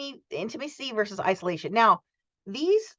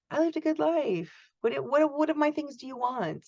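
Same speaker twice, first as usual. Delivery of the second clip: put-on higher, lightly quavering old-person voice; mock a grandparent giving things away; imitating grandparent; 7 semitones higher than usual. Teacher imitating an aging grandparent, mild mock quaver. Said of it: "starting to talk like this".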